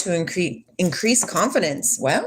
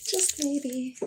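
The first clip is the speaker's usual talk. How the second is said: quiet; 8 semitones higher, 8 dB quieter than usual. Shown as a demonstration. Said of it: "repeated softer".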